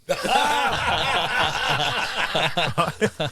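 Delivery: helpless laughing fit; real burst of laughter. Real, not acted. Storyteller laughing at his own pilot story. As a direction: breaks up laughing at the punchline.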